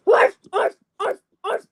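loud high yapping barks; dog barking. Pretend. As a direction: bark like a puppy.

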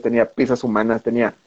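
usual speaking voice

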